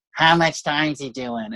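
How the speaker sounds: slow, whiny club-owner impression; whiny matriarch, slowly drawled; Mitzi Shore. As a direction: drag it out in a mock whine. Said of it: "she had this voice like this".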